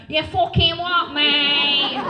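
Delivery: slow, loud mock Manc challenge; laughing put-on Manchester accent. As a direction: lean into a Mancunian "what, mate?". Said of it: "I'm like".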